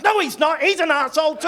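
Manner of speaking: loud laughing rant; gleeful shout. Real, not acted. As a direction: bellow it in delighted outrage. Comic outburst about Matt Damon.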